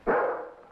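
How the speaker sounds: quick breathy laugh; a short, unvoiced burst of a laugh. Boy laughs at dog's reaction.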